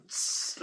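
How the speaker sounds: soft unvoiced tss; quiet slicing tss sound; throat-cut hiss. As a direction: hiss like a blade across the throat. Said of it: "like that".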